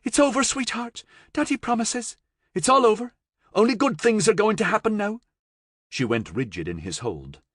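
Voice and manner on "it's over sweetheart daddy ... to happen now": put-on high, gentle reassurance to a child; calm a scared little girl; soothing. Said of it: "he soothed".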